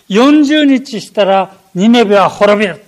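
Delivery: loud, ringing proclamation of doom; prophet's proclamation. Retold or imitated. Jonah warning the city of Nineveh, loud proclamation. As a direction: call it out across the city like a prophet. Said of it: "こう叫んだわけです".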